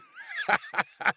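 high, jeering cackle; mocking laugh. A narrator's reading. Bully laughs at his own paint threat, cackling. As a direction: laugh nastily at your victim. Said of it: "He laughed".

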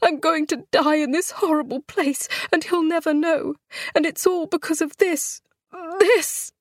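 high, strained scream rising to the last word. A narrator's reading. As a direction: shriek it out in agony. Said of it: "she screamed".

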